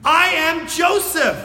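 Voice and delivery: Joseph's overwhelming self-reveal; emotional cry. Acted. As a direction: cry out the truth at last. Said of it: "Imagine them hearing that".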